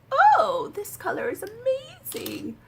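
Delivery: high breathy delighted whisper; excited gasp. Retold or imitated. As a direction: gush in a hushed thrilled voice. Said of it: "I was like".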